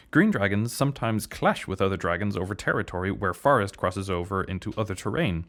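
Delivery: ordinary talk, nothing marked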